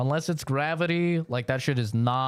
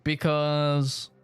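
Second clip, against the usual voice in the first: defensive pleading; 8 semitones higher than usual. Retold or imitated. Arguing with angry dad.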